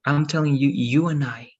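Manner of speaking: normal talking voice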